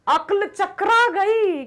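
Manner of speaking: dumbfounded exclamation; stunned shock. Acted. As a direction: stretch it out in disbelief. Shock at seeing the snake.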